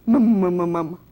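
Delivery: loud, defiant repeated word. Acted. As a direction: chin up, say it loud and proud. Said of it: "Jenny lifted her chin defiantly".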